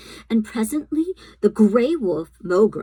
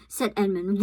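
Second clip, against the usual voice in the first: ordinary delivery, nothing marked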